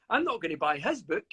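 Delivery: normal talking voice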